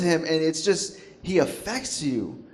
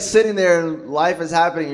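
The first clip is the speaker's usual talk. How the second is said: ordinary delivery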